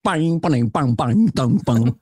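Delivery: twanging mouth-made bass riff; bass line imitation. Shown as a demonstration. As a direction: pop out the sitcom bass notes. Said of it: "the goddamn bass line".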